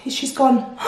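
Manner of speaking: usual speaking voice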